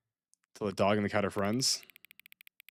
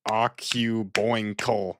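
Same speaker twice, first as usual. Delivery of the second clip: "Occuboinkle" very slowly, syllable by syllable; four separate syllables; 3 dB louder, much slower than usual. Shown as a demonstration. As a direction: count out the four beats of "Occuboinkle". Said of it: "That's four syllables".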